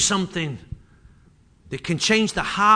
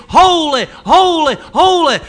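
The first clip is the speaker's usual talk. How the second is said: loud, higher cries of holy; seraphs' cry; 7 semitones higher, 10 dB louder, slower than usual. Acted. Preacher echoing the seraphs' cry, loud and high. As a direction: ring it out like angels.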